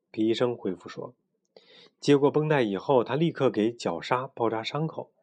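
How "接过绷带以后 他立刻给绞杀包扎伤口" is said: plain reading voice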